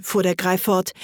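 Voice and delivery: ordinary delivery, nothing marked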